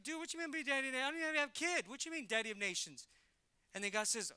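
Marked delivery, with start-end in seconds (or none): 0.0-3.1 Abraham's reply. Retold or imitated.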